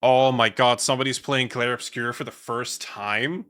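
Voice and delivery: normal talking voice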